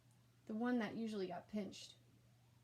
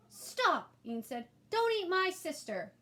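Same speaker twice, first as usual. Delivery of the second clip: put-on much higher, louder child shout; brave shout; 9 semitones higher, 7 dB louder, slower than usual. A narrator's reading. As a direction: burst in shouting to save her. Ian bursts in protecting sister.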